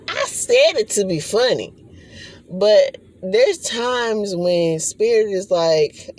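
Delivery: ordinary talk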